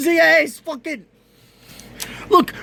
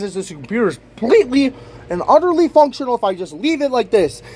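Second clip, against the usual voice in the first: ordinary talk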